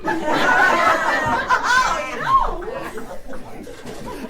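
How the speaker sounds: helpless high-pitched laughter; roaring laughter at the punchline; long high laughing fit. Real, not acted. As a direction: laugh until out of breath. Laughing at Steve Martin joke.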